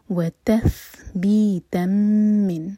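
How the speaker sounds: voiced, very slow tajweed recitation; slow Quran recitation. Shown as a demonstration. Teacher recites the verse to show the rules, slowly. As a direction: draw each syllable out carefully. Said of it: "تا کی تنوین کے بعد میم".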